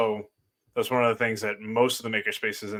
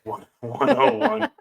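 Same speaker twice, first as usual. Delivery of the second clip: teasing mock repeat; 13 semitones higher, 7 dB louder, slower than usual. Teasing her beginner class answer.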